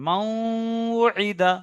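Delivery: মাউইদা very slow, nasal merge sustained; ghunna demo. Shown as a demonstration. Teacher showing nun merged into waw, drawn-out nasal recitation. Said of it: "একালিক পরিমাণ গণনা করে পড়তে হবে".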